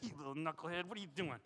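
a hint of a gruff affectionate rebuke; gently chiding a wayward friend, low and soft; Jesus scolding. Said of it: "That's how he does it with me".

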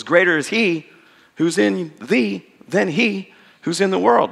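put-on slow, measured recitation of the old verse; King James recitation. Shown as a demonstration. A lightly intoned King James recitation. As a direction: savour the rhyme of the King James wording. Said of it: "which rhymes quite nicely".

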